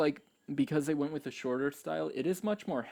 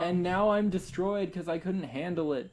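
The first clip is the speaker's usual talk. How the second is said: higher-pitched, hammy lament of defeat; mock melodrama; 6 semitones higher than usual. Mocking the cliché fight ending, melodramatic wail. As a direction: play an overblown downfall.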